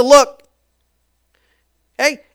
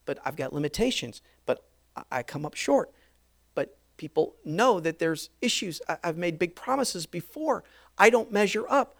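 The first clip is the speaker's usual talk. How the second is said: softer, lower, self-doubting murmur; quiet inner doubt; 6 semitones lower, 11 dB quieter than usual. Acted. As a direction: Peter doubting himself inwardly, softer and lower.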